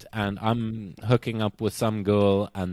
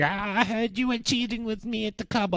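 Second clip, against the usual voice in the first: high accusing girlfriend impression; lightly voiced accusing girlfriend; mock girlfriend voice; 10 semitones higher, 4 dB quieter than usual. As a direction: play the upset girlfriend, pitched up. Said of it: "is like".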